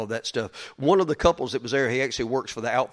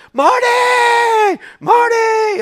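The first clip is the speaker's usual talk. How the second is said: much higher, loud crowd calls of Marty; fans shouting; 10 dB louder, much slower than usual. Retold or imitated. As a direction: call out Marty like an excited fan. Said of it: "all these people all over the airport".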